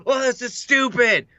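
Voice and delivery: high-pitched mock exasperation; retold yell. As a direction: imitate your own gaming outburst. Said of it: "like, yell or something like that".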